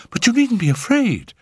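put-on high-pitched, urgent young lady; crying out. A narrator's reading. Phyllis reassuring the men running with her, crying out. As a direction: call it out high as you hurry. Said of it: "she cried".

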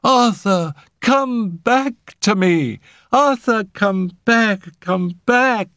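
high, loud, drawn-out pleading; desperate cry. A narrator's reading. Phyllis begging lifeless Arthur to return, desperate cry. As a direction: wail to bring him back. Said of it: "she cried desperately".

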